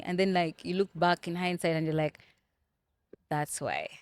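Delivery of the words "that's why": low, soft quoted thought; quiet realisation. Retold or imitated. Voicing what you think looking back in hindsight, quiet realisation. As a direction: say it low and softly, as if it just clicked.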